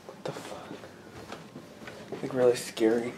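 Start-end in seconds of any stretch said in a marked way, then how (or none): 0.1-0.7 whispered in fear; terrified whisper; scared whisper. Real, not acted. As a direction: breathe it so nothing hears. Frightened downstairs at night.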